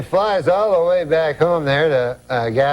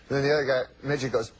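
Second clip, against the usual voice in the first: normal talking voice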